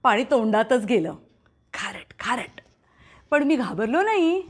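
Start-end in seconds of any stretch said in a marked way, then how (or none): 1.7-2.6 unvoiced whisper of disgust; whispered salty. Boy remembers swallowing sea water, whispers. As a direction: breathe it out, almost silent.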